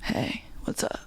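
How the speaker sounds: breathy whisper, playing it cool; whispered pickup line. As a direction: flirt in a whisper. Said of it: "walks kinda like".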